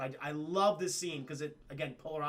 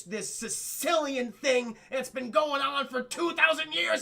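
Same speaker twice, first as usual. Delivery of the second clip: rising to a loud, high crying yell; Kay's outburst; 9 semitones higher, 6 dB louder, slower than usual. Retold or imitated. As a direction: let it break into a shout. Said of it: "She goes".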